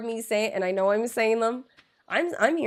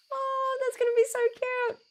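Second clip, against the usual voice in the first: cooing; 13 semitones higher than usual. Real, not acted. Cooing about a baby.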